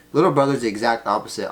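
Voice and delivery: ordinary talk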